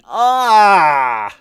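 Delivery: a loud, anguished cry of frustration; frustrated yell. Real, not acted. Streamer who lost his ring yells in frustration. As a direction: let out a real yell of despair.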